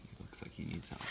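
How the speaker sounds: plain reading voice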